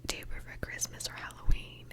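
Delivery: usual speaking voice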